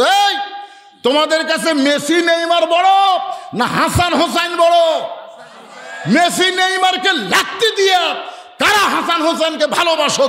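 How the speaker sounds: high shouted challenge to the crowd; rally the congregation with ringing shouts; fiery, high-pitched exhortation; full-force preaching. Real, not acted.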